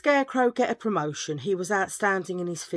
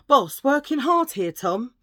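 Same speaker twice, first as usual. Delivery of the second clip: louder, higher mock shout of Boss; hammed-up call to the boss; 5 semitones higher, 7 dB louder than usual. Employee loudly greets the boss on the stairs. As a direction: sing out a fake busy greeting. Acting out the joke again.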